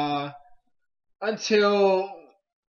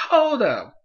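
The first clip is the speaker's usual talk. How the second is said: startled halt; 6 semitones higher, 8 dB louder than usual. Retold or imitated. Acting own shock at Bonnie vanishing.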